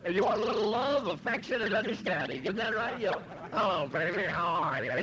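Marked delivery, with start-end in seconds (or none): 3.5-4.9 butter her up gently; sweet-talking. Retold or imitated. Sweet-talking wife on arriving home drunk.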